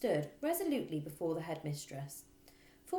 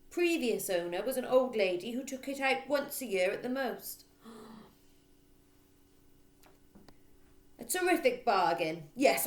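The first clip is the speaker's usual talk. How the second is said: loud bossy headmistress; 3 semitones higher, 7 dB louder than usual. Acted. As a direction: boom on like a bully. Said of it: "She either barked or shouted".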